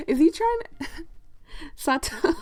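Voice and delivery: giggling through the words; breaking up mid-question; laughing. Real, not acted. Laughs at Sato's cat pun.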